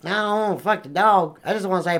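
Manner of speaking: ordinary talk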